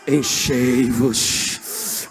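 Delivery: loud, hugely drawn-out command; forceful exhortation. Real, not acted. Preacher at the peak of his call to be filled, loud and drawn out. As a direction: stretch it out with full breath and force.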